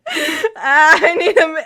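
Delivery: laughing fit. Real, not acted. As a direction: try to talk through a laughing fit. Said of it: "I just need a minute".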